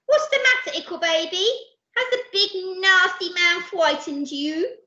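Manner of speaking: high, loud cooing; baby talk. Acted. Percy comforting the crying baby, high baby talk. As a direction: coo babyishly in a high voice.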